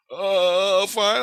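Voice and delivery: a hammed-up "girl at the desk" reply; mock receptionist. Girl at lab desk answers the intruder, mock receptionist.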